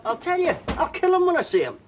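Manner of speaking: high, loud furious yell; furious shout. Acted. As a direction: let the rage burst out. Father furious at missing son.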